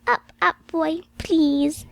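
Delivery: put-on high, lightly coaxing child's plea; a hint of a toddler wheedling. A narrator's reading. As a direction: coax him gently like a little girl. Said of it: "she said again in a coaxing voice".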